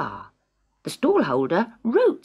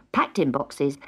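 ordinary delivery, nothing marked

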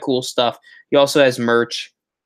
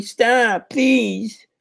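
stupid voice; 10 semitones higher than usual. Pretend. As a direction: put on a silly high voice, rising in mock distress. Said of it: "I'm doing my stupid voice".

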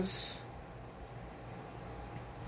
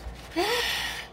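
high, sharp gasp of surprise; surprised gasp; 9 semitones higher, 4 dB quieter than usual. Real, not acted. Surprise at new gift.

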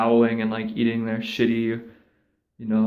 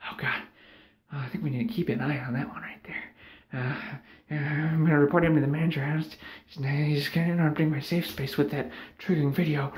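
hushed then higher, fast tattling mock voice; offended coworker; 4 semitones higher, 6 dB quieter than usual. A coworker threatening to report him to the manager, hushed and prissy. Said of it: "everyone's like".

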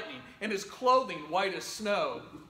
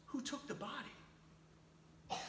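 hushed question; 3 semitones higher, 15 dB quieter, much slower than usual. Pretend. Imagined reporter questioning the women.

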